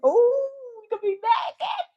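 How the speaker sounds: whooping high cry of recognition. Real, not acted. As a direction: shout it up high, amused. Preacher cries out.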